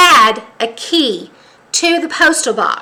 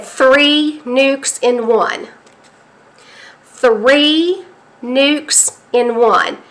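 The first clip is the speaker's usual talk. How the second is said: each word slow and heavy, like a grim headline; slow dramatic read of a doom headline; slow ominous headline read; much slower than usual. Retold or imitated. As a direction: let the words land one by one, dread underneath. Reading the dream headline aloud.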